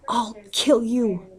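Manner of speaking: slow, high, drawn-out furious threat; shrieking threat. A narrator's reading. Frantic mother shrieks at the kidnapper, high and furious. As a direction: shriek it at the thief who took her baby. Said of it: "Anne shrieks".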